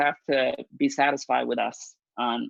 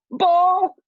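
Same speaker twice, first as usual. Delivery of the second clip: a squeaky, much higher and louder yelp; umpire's call; 7 dB louder than usual. Retold or imitated. Boy umpire calling a ball, a loud high yelp. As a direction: bark the call like a teenage ump.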